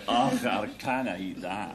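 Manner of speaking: mock thick Scottish accent, laughing; a jokey Scottish wife's line, loud; hammed-up Scottish brogue, giggling. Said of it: "spoke with a thick Scottish accent".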